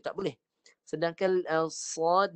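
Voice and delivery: usual speaking voice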